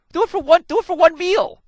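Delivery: high, loud plea; insistent urging. Real, not acted. Speaker urging audience to try noticing their food, insistent. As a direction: push it hard, high and loud.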